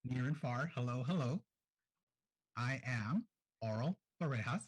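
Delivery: ordinary talk, nothing marked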